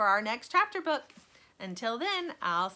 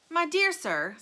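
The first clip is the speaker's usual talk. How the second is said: warm Wonka welcome; 9 semitones higher, 5 dB louder than usual. A narrator's reading. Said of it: "said Mister Wonka, warmly".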